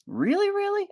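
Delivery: squeaky high disbelieving really really; very high doubtful. Pretend. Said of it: "And you'll be like".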